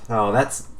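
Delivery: ordinary delivery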